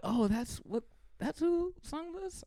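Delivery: high, slow astonished question; surprised. Retold or imitated. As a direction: realize it in wonder. Retold surprise hearing song.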